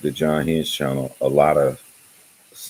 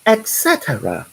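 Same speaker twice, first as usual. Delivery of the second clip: much higher, prim British "Et cetera"; mock British accent; 13 semitones higher than usual. Mimicking Stephanie's reading of his post, in a mock British accent. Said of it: "in that British accent".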